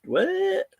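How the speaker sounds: sudden high squeak of confusion; startled, high-pitched what; baffled what. Real, not acted. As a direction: jump way up in disbelief. Baffled by odd chat name.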